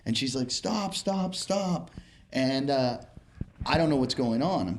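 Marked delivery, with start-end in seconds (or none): 0.5-1.9 high, light-voiced cries to stop; imitated pleading woman. Woman flagging down the driver, high mock pleading.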